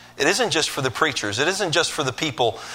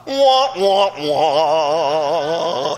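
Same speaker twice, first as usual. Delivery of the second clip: sad trombone wah wah; 3 dB louder, much slower than usual. Retold or imitated. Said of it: "what's the sound when the goat comes out".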